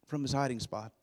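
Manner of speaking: normal talking voice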